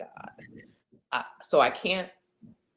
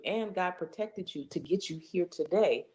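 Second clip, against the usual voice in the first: usual speaking voice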